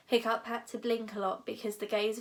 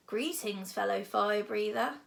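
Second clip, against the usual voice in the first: plain reading voice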